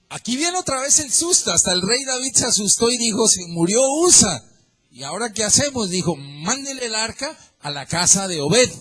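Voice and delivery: high, loud, alarmed; frightened alarm. Acted. King David frightened after Usa dies, high alarmed outcry. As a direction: panic rising in a king's voice.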